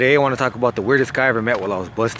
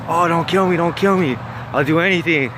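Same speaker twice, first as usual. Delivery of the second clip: higher-pitched retold begging; mock pleading; 5 semitones higher than usual. Victim begs the sword carrier, mock pleading. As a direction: imitate a victim pleading, lightly acted.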